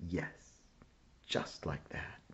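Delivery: hushed, gentle yes during a hug; whispered loving praise; tender whisper. A narrator's reading. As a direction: soothe the child softly. Mother hugs Emma.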